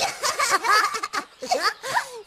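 bright open laughter; warm laugh. Real, not acted. Laughing with the baby at the upside-down book.

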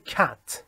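cat with a plain short "a" as in British English; crisp British "cat", pure short a. Shown as a demonstration. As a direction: say "cat" with one clean, unglided vowel.

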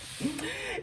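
high laugh. Real, not acted. Laughs after rant.

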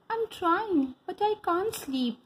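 put-on small high young rabbit voice; little Lily. A narrator's reading. Lily telling her mother she can't sleep, high and small. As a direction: pipe up like a sleepless little bunny.

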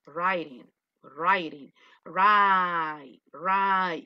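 "writing" as RYE-ding, "right" drawn out as rye; stretched AI sound in "right"; correct vowel drilled. Shown as a demonstration. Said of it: "¿Y por qué es right".

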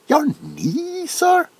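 can't believe the request; incredulous. Acted. Doctor told to listen to knee.

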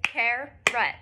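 carrot split into two clapped beats; syllable by syllable, car-rot. Shown as a demonstration. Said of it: "Now I'm gonna do it".

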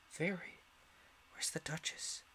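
hushed whisper; Alice asking the rabbit softly; whispered question. A narrator's reading. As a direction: ask under the breath. Alice asks quietly.

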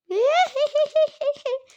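high, loud burst of laughter. Real, not acted. Mother laughing at herself during play shopping, loud laugh. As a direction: crack up loudly at your own mistake.

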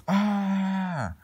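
high, loud, held play scream. Pretend. Screaming again in the line, loud mock shriek. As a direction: shriek out suddenly and hold it. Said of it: "Who was screaming again?".

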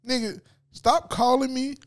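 ordinary delivery

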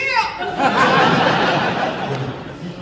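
loud, high burst of laughter; big laugh. Real, not acted. Actor laughing as a fan shouts back, loud laugh. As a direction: laugh out with delight.

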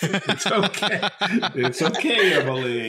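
high, loud laughing reassurance. Real, not acted. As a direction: reassure her through laughter.

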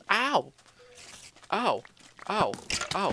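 loud sharp ow cries; pained yelps. Real, not acted. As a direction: yelp from each hit. Hit by a mob in game.

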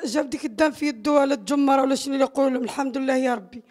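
ordinary delivery

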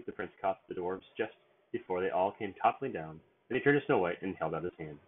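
ordinary delivery, nothing marked

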